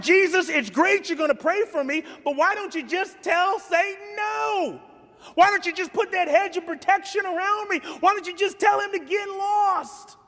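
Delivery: high-pitched, insistent protest; pleading as Simon. Acted. Preacher voicing Simon questioning Jesus, high-pitched pleading. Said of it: "Because if I'm Simon, I'm like".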